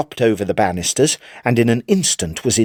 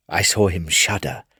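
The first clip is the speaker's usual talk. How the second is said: plain reading voice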